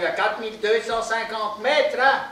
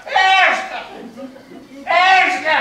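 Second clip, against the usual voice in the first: much higher, louder call; 10 semitones higher, 4 dB louder than usual. Acted. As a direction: shout his name down the crevasse. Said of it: "il dit".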